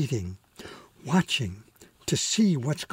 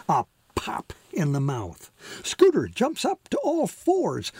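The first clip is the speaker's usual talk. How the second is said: usual speaking voice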